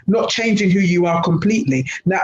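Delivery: ordinary talk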